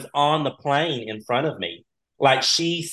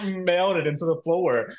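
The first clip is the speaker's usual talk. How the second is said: ordinary talk, nothing marked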